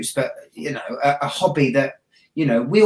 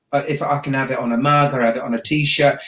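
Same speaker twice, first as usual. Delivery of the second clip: ordinary talk, nothing marked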